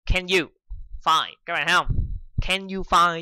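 "Can you find" with CAN and you pulled to the front and lifted; teacher stressing "Can you find" over "you can find"; stress the inversion. Shown as a demonstration. A teacher shows students the inverted order, stressed. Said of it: "chứ không phải là you can find".

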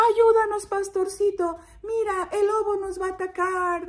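high, alarmed plea; pleading sheep. Acted. Sheep warning shepherd of the wolf, alarmed. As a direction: beg for rescue in a high voice. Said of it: "decían todas las ovejas".

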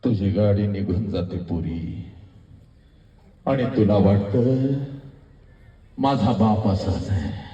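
low, slow narration with heavy pauses; grave, measured telling; somber storytelling. Acted. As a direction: drop low and let each phrase sink. Hushed turn in the story.

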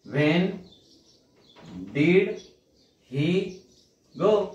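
slow, each word on its own; word-by-word dictation. Shown as a demonstration. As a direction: dictate slowly. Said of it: "dictating word by word".

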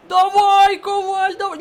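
real shout of encouragement at the screen; shouting at player. Real, not acted. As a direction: scream the player on to run. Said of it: "Я кричу Коваль".

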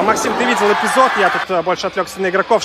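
normal talking voice

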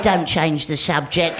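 slow low mock threat; gruff robber. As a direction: drop the voice down for the robber. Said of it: "He said".

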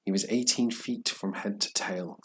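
plain reading voice

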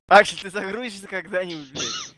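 raging gamer. Real, not acted. Still waiting for Axel to load.

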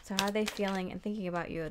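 ordinary delivery

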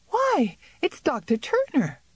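high, startled cry of recognition; cry of surprise. A narrator's reading. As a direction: gasp out the name in sudden surprise. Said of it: "gave a cry of surprise".

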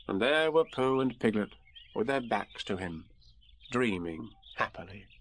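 ordinary delivery, nothing marked

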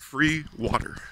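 normal talking voice

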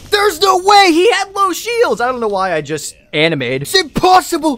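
real outraged shouting at the screen; disbelieving yell. Real, not acted. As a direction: rail against the game. Disbelief at a lost duel.

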